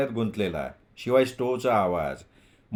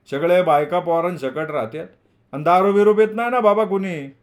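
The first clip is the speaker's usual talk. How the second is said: loud, high-pitched village elder; landlord, approving then cautioning; 5 semitones higher, 5 dB louder than usual. A narrator's reading. Landlord permits the boys' cooking but warns against noise, voice raised.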